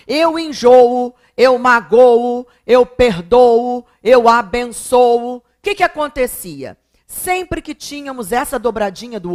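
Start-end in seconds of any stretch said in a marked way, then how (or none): 0.1-5.4 loud, slow list with enjoo, magoo, perdoo, abençoo each held on a long ô-o; chanting enjoo, magoo, perdoo, abençoo loudly with swollen final vowels; stretched double O. Shown as a demonstration. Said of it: "Lembra daquela dobradinha de E e dobradinha".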